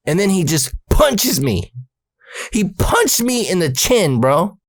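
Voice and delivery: high, drawn-out disbelief; incredulous outrage. Real, not acted. Teller complains his brother punched him, incredulous. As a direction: stretch the key words in indignation.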